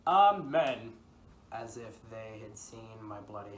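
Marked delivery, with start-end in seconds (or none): 0.1-0.9 Amen shouted much louder and higher; servant's shout. Retold or imitated. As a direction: bellow Amen as a frightened servant. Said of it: "And the other cried".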